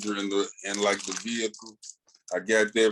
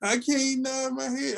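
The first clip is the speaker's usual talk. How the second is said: high, slow whiny put-on voice; hammed-up complaining fan voice; mock disappointed fan; slower than usual. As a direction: whine like a let-down listener, pitched way up. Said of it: "I've been reading it".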